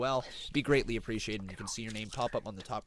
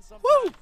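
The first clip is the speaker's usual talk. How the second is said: high, loud celebratory whoop; 13 dB louder than usual. Real, not acted. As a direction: cheer like a wrestling crowd. Celebrating new boss Shell.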